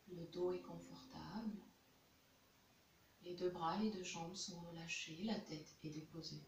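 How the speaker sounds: soft, unhurried meditation voice with long pauses; guided meditation. Real, not acted. Yoga teacher guiding students into Yoga Nidra, soft guided meditation.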